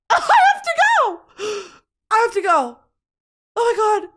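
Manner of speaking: high, loud mock panic; hammed-up urgent escape cry; panicked exit. Woman fleeing her date's place, panicked.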